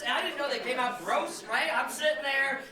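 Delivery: normal talking voice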